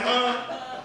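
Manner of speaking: mock dazed cokehead huh. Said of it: "He's like".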